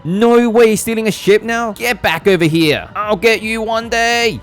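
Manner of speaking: loud high shout after escaping villains; cartoon hero shout. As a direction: yell after them, hammed up. Shouting at fleeing Team Aqua.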